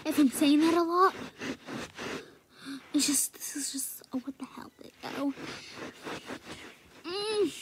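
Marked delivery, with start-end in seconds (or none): 2.9-5.3 hushed sheepish aside; guilty whisper after swearing. Real, not acted. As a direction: whisper it like a confession.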